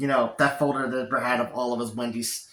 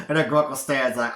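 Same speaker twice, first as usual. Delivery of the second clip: ordinary delivery, nothing marked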